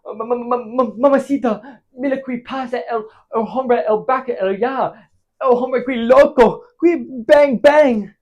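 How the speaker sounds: high, stammering mock Spanish alarm; stammer, then build to shouted bangs; panicked Spanish outburst. Acting panicked Ramiro shouting in Spanish.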